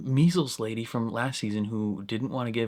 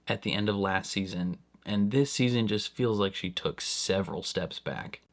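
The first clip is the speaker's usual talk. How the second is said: ordinary delivery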